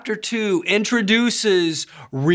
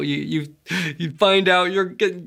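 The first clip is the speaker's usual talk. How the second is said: usual speaking voice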